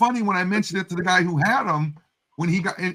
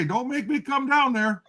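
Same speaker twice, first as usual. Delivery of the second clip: slower, higher mock threat; quoting his catchphrase; 7 semitones higher, slower than usual. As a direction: drag it out like a mock warning from above. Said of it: "you hear him say".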